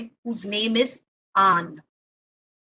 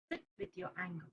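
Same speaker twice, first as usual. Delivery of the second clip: very low, hushed instruction; quiet, patient elder voice; calm grandpa; 6 semitones lower, 21 dB quieter, much faster than usual. Acted. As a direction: softly counsel an upset child. Grandpa's calm advice to boy.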